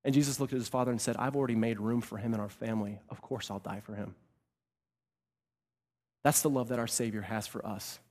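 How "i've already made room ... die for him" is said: softly voiced, low reply; tender assurance. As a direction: let it drop low and quiet, lovingly sure. Jesus tenderly answers his father.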